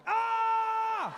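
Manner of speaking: sudden high startled yelp. Retold or imitated. Startled by the President, a high yelp. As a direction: jump out of your skin with a yelp.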